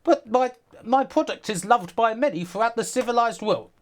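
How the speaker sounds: hammed-up high pleading salesman; [name] pleading. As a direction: beg like a desperate salesman. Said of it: "telling [name]".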